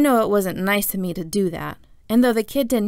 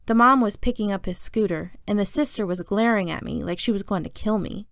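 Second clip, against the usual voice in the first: plain reading voice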